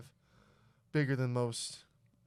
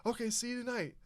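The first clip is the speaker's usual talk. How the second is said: imitating himself; 9 semitones higher than usual. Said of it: "I'm like".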